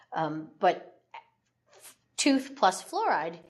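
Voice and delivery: usual speaking voice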